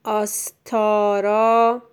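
آستارا slowly split into syllables, loud; slow dictation. Shown as a demonstration. Teacher dictates for a pupil writing, slow and loud.

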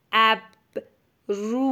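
ابرو split, with a long rising final u; sounds stretched. Shown as a demonstration. Teacher dictates a word to a pupil, slow and split. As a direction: pause after اب, then draw out رو. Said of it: "صدا کشی کن و بنویس".

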